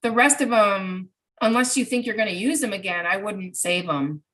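ordinary delivery

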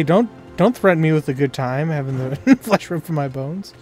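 normal talking voice

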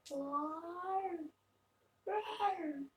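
normal talking voice

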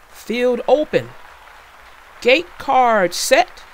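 high, very loud battle calls; ringing duel command, drawn out at the end; shouting. A narrator's reading. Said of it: "the brawlers shouted".

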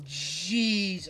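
ordinary talk

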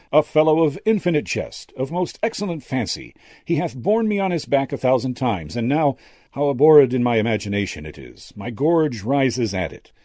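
high, loud theatrical Shakespeare; actor's quotation. A narrator's reading. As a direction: declaim Hamlet like an old stage actor. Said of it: "the old actor continued the quotation".

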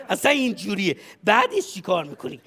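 ordinary talk

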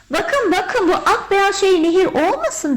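high, loud, excited discovery; excited calling. A narrator's reading. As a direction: call the children over eagerly. Mother calls children to look.

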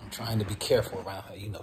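normal talking voice

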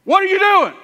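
high, loud shout of outrage; shocked high yell at the walking man; religious leader shouting. Acted. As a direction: bark him to a halt. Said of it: "the religious leader goes".

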